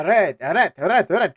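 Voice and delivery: high, loud repeated command; hammed-up French order to halt; mock French police shout. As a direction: yell it like comic French police. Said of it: "whatever they say in French".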